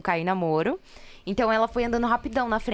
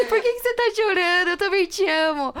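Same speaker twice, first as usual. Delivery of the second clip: put-on high, louder boyfriend's tearful plea; Caio's voice; 10 semitones higher, 5 dB louder than usual. Boyfriend asking his crying girlfriend why she cries, high and pleading. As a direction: play the boyfriend, much higher and louder, near tears. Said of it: "Eu ouvi a voz do Caio agora".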